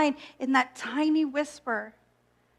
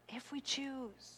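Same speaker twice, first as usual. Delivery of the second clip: soft echo; 19 dB quieter, slower than usual. Shown as a demonstration. Echoing her own words to the congregation, hushed and slow. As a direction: repeat it much softer and slower.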